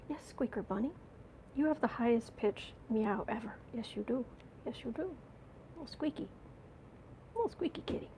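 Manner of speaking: soft whispery pet talk; talking to the cat. Real, not acted. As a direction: coo softly to the kitty. Cooing to the cat.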